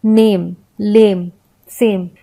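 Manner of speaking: "नेम, लेम, सेम" with long ay and silent e; silent final e. Shown as a demonstration. Teacher listing silent-e words, demonstrated one by one. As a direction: end "नेम, लेम, सेम" on the m, no vowel after. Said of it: "ई का अपना कोई उच्चारण नहीं है".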